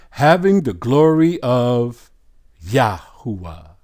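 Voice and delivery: loud, slow scripture reading; scripture proclamation. Real, not acted. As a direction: proclaim it with drawn-out words. Preacher reads scripture aloud.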